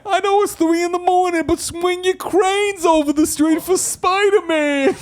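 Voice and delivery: very high, loud shouting crane; crane impression. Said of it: "People want the crane impression".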